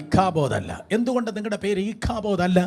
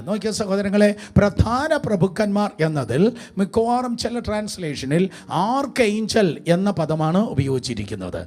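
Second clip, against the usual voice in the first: ordinary talk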